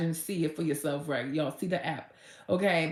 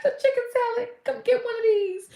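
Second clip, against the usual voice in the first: overwhelmed cry of joy; 3 dB louder than usual. Retold or imitated. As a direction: cry out to Jesus overcome with excitement. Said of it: "I said".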